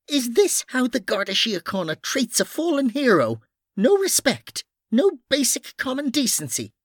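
high, outraged scolding; indignant woman character. Acted. Noreen berating two scuffling men over a fallen hero, outraged.

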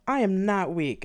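breathless angry insistence; said angrily. Acted. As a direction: bite out each word. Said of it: "I said angrily".

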